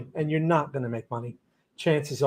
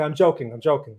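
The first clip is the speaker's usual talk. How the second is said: ordinary delivery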